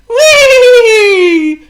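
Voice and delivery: very high, loud, long sweeping shout; majestic whee. A narrator's reading. Potto flies through a cloud, majestic shout. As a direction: sail through the clouds grandly. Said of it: "shouted Potto majestically".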